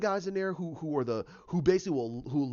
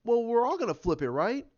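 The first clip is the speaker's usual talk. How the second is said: Harley teasing; 6 semitones higher, 5 dB louder than usual. Retold or imitated. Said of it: "And she goes".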